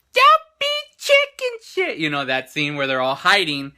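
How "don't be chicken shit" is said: loud, very high little squeal of a voice; imitating movie girl. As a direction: mimic her shouting in a high-pitched voice. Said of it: "she comes out and she's like".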